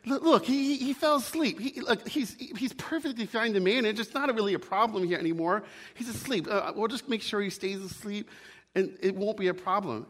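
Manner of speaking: high-pitched, hasty pleading as the ghost; acted anxious excuse-making. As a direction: talk the angel out of it. Ghost anxiously excuses the sleeping lizard.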